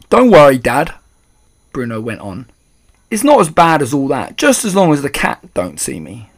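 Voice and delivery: put-on loud cheeky little boy. A narrator's reading. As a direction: pipe up cheekily. Boy mouse reassures father.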